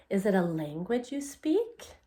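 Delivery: ordinary talk, nothing marked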